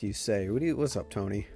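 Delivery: ordinary talk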